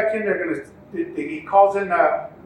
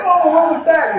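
much higher, louder startled question; 11 semitones higher, 9 dB louder than usual. Retold or imitated. Injured rider reacting to the loud pop, startled high question. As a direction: caught off guard, half laughing. Shoulder pops back in.

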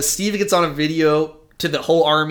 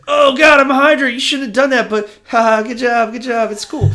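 high, loud, conflicted laughing Captain America; torn Steve; 9 semitones higher, 4 dB louder than usual. Retold or imitated. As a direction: torn between duty and friend, laughing nervously. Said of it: "And Steve's like".

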